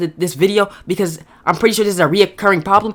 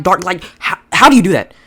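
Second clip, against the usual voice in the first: normal talking voice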